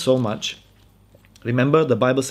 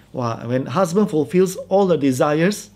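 usual speaking voice

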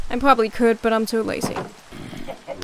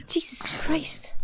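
whisper; 4 semitones higher, 6 dB quieter, slower than usual. Real, not acted. Whispered exasperation.

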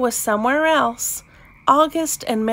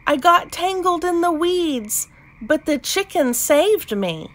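higher storybook character voice; calling out; 7 semitones higher than usual. A narrator's reading. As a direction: lightly call out the explanation, pitched up. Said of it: "she called".

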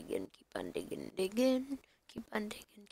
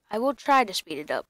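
ordinary talk

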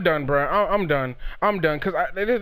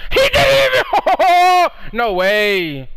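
laughing disbelief; 7 semitones higher, 4 dB louder, slower than usual. Real, not acted. Laughing disbelief during game.

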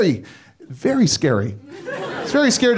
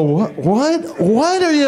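high, startled double take; high startled what; startled squeak; 7 semitones higher, slower than usual. Retold or imitated. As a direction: jolt awake and squeak at the stranger. Dog's startled wake-up voice.

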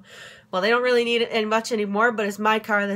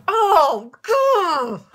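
exasperated groan; 7 semitones higher, 6 dB louder than usual. Real, not acted. Exasperated outburst after the rant.

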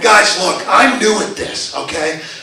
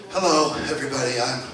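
very soft, low, halting mumble; hesitant, underpowered promo, full of pauses; badly undersell yourself, low and quiet; timid wrestler intro; 8 semitones lower, 12 dB quieter than usual. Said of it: "if I shuffled out and said".

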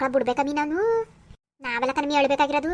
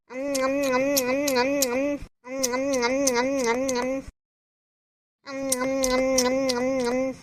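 gobble the food with smacking mouth noises; noisy munching; 2 semitones lower, 3 dB quieter, slower than usual. Acted. Hungry daughter wolfing food. (ಬಾಯಿ ಚಪ್ಪರಿಸಿ ಗಬಗಬನೆ ತಿನ್ನುವ ಸದ್ದು)

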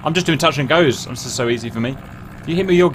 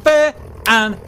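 triumphant cheer; 8 semitones higher, much slower than usual. Real, not acted. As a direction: crow over the win.

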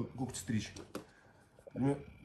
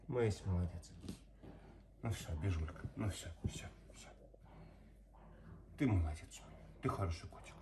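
soft soothing; 6 semitones lower, 9 dB quieter than usual. Real, not acted. Calming the dog.

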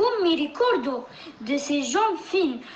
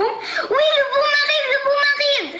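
shouting; 10 semitones higher than usual. Acted. Animals shout warning.

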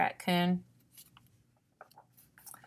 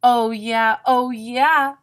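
insistent; 5 semitones higher, 9 dB louder than usual. A narrator's reading. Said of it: "Raccoon went on".

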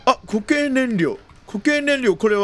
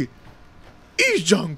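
usual speaking voice

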